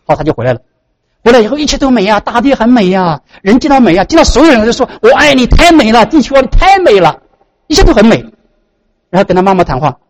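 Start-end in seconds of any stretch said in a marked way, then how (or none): 1.2-8.2 high, rapturous exclaiming; retold wonder. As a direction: marvel aloud as if seeing the world afresh. Retold joy at reborn beauty.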